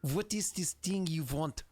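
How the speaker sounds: slow, thick put-on accent; Shinny accent. Shown as a demonstration. Game master demonstrates a terrible accent, slow thick put-on accent. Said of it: "if I do my Shinny accent".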